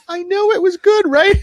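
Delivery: very high, loud, squealing delight; gleeful agreement. Retold or imitated. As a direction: gush like a thrilled fan. Dad answers daughter's praise.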